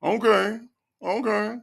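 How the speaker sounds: high-pitched, meek "okay"; mock cowering Dre. Host mimicking Dre giving in, mock meekness. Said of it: "the way Dre cowers down".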